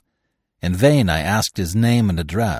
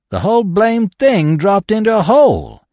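much higher and louder, dramatic; excited telling; 9 semitones higher, 6 dB louder than usual. A narrator's reading. Trapper recounting the glacier collapse, loud and excited. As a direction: blurt out the catastrophe.

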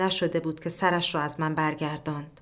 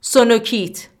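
loud, higher, slow stunned shout; 5 semitones higher, 8 dB louder than usual. Acted. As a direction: yell it across the ward in disbelief. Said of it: "کسی داد زد".

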